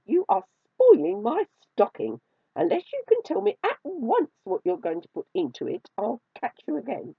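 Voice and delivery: high, loud, indignant threat; cross, high-pitched storybook cat; scolding kitten. A narrator's reading.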